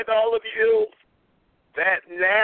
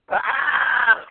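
frustrated shout. Real, not acted. Cuts off his insult.

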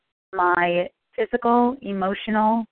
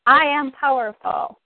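higher, slow, punched affirmation; emphatic affirmation; 6 semitones higher, 3 dB louder than usual. Shown as a demonstration. Leader of a phone call group affirming power, slow and high. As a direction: declare it with power. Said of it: "caretakers and training call".

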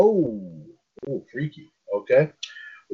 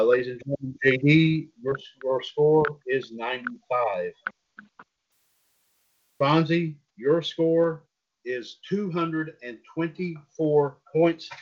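booming pumped announcer; 2 semitones higher, slower than usual. Acted. Announces final scores.

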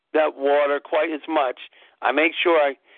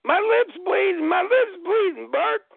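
much higher, frantic movie-hero cry; old-Hollywood impression, high and urgent; James Stewart. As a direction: imitate the famous film outburst. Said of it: "he yells out".

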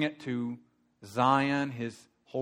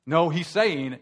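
usual speaking voice

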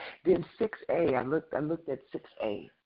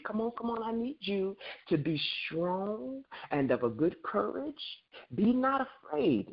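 urgent divine plea; 7 semitones higher than usual. Acted. God urging weeping Joshua to be strong.

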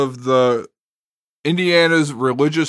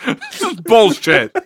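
higher, amused complaint through laughter; laughing protest; 9 semitones higher than usual. Real, not acted. Laughs protesting at being teased.

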